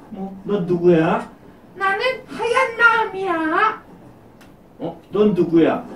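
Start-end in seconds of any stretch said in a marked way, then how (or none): 1.7-3.8 much higher, airy little spirit voice; tiny high voice of a good conscience; high gentle spirit voice. Acted.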